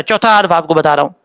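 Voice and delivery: ordinary talk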